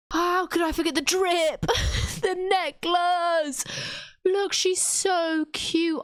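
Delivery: high, drawn-out squealing; slow, high-pitched gushing; gushing dismay. Real, not acted. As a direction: coo over it with exasperated delight. Gushing over necklace she forgot.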